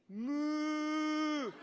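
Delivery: high, drawn-out mock moo; acted-out cow moo. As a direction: moo like a cow to explain beef. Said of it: "she literally acted it out".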